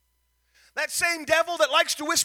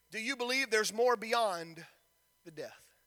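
hushed question; 3 semitones lower, 8 dB quieter than usual. Acted. Lord questioning Ezekiel.

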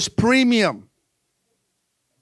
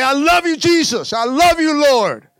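higher, louder, eager "I love you, Jesus"; eager love call; 6 semitones higher, 4 dB louder than usual. Retold or imitated. Waking up and telling Jesus, eager and raised. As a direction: gush it out like a joyful morning prayer. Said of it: "did you wake up and say".

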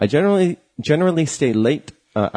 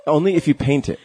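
laughing; 8 semitones higher than usual. Real, not acted. Joke punchline through laughter.

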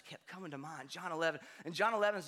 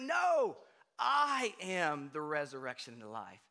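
emphatic declaration; 3 semitones lower, 4 dB louder than usual. Retold or imitated. Jesus correcting the grieving sister, loud and emphatic. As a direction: insist on it with force. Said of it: "he's like".

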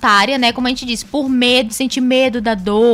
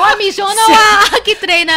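louder, higher laughing tease; 8 semitones higher, 7 dB louder than usual. Real, not acted. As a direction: laugh through the joke. Laughing, teasing.

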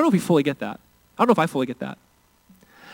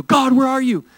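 high-pitched mock cry to God; raised, pleading demonstration yell; 8 semitones higher, 4 dB louder than usual. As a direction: call out as if God were far off. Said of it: "we don't need to yell and scream and go".

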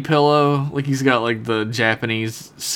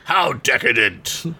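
slow, put-on scornful Worf; mock Worf disdain; slower than usual. Worf judging Troy's lunch, mock disdain. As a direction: stretch it out with hammed-up disapproval.

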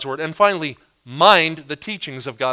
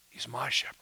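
whisper; 4 semitones lower, 11 dB quieter than usual. Real, not acted. Intimate emphasis on my shepherd.

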